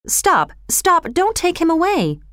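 high, urgent cry to halt; narrated outcry, pitched well up. A narrator's reading. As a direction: call out high to stop them. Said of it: "Suddenly a voice cried out".

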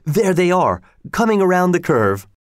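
a spectator's eager shout; excited call. Acted. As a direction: call out as the horses appear. Race comes into view.

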